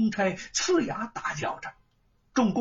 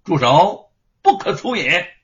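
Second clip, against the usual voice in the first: much louder commanding cry to stop; stern shout; 10 dB louder than usual. A narrator's reading. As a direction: stop them dead with one shout. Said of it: "忽听有人呵斥".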